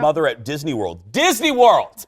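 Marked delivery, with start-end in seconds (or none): 1.1-2.0 higher-pitched incredulous exclamation; appalled disbelief; indignant emphasis. Real, not acted. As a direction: repeat it as if scandalized by the place. Indignant repeat of the shocking setting.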